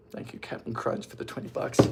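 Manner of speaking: soft unvoiced whispered thanks. Real, not acted. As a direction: breathe the thanks without voice. Thanks donor while adding sugar.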